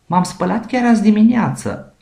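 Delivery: high, loud indignant protest, azi hit hard; angry bear. A narrator's reading. Bear refusing the penguin's soap, angry and high. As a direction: snap back offended at the penguin. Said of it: "se supără ursul".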